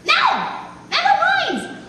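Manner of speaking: indignant refusal. Acted. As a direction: shut the question down in a high, exasperated cry. Old woman refuses to talk.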